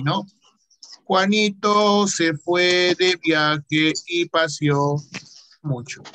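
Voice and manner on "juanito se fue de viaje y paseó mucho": slow, drawn-out dictation; typing aloud. Shown as a demonstration. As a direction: say each word as you type it. Says a sentence while typing it.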